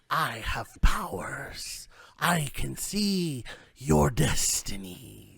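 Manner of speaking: slow, whispery mystic crone; old lady fortune teller. Acted. Old woman promises to tell destinies, slow whispery crone voice. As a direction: draw it out slowly, half whispered and eerie. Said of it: "old, old lady".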